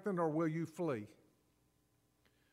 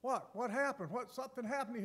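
puzzled crowd; 6 semitones higher, 4 dB quieter than usual. Retold or imitated. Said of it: "everybody says".

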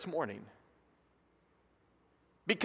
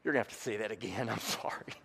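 embarrassed aside; 9 semitones lower, 13 dB quieter, much faster than usual. Retold or imitated. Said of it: "I looked at Scott".

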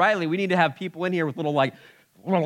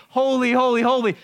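quick, fervent triple holy; fervent praise; 9 semitones higher, 5 dB louder than usual. Retold or imitated. Creatures crying out to God, fervent and loud. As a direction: call out the praise. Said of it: "all they have to say is".

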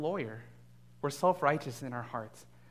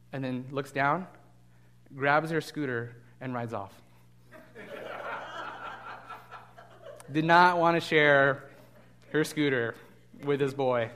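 ordinary talk, nothing marked

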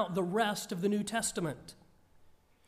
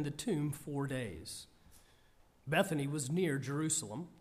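calm, measured Bible reading, a touch lower; scripture reading; 3 semitones lower than usual. Acted. Reads the scripture passage aloud.

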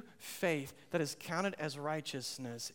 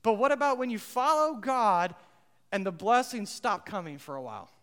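ordinary talk

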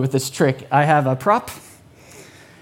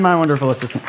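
ordinary talk

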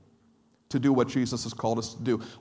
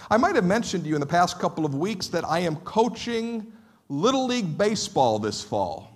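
ordinary delivery

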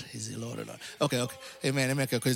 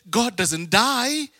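normal talking voice

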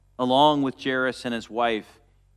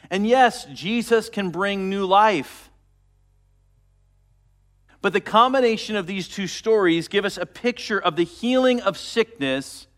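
louder preaching at full force; 4 semitones higher, 4 dB louder than usual. Acted. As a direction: drive it home to the congregation.

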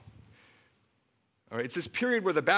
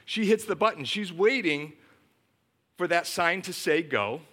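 ordinary delivery

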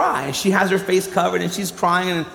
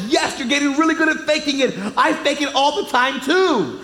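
higher, sing-song big sister; cheeky child; 7 semitones higher than usual. Retold or imitated. Sister across the table confesses she fakes it too, cheeky. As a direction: tease like a proud older girl. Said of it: "She's like".

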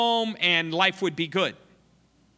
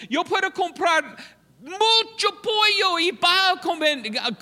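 much higher, louder, rallying shout in broken Spanish; rally the crowd in halting Spanish; shouted Spanish appeal; 12 semitones higher, 6 dB louder than usual. Retold or imitated. Missionary with microphone rallying Panamanians, high excited shout. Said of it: "I said".

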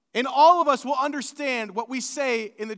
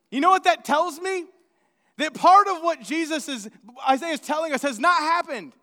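normal talking voice

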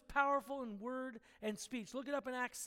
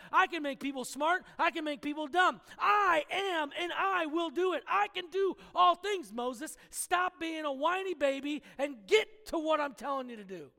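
loud, powerful scolding, often higher; booming God voice; 4 semitones higher, 7 dB louder than usual. Acted. God scolding Moses from the fire, loud booming rebuke. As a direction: thunder down at a whiny Moses. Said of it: "booming voice screaming essentially at Moses".

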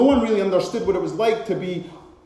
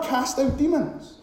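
ordinary talk

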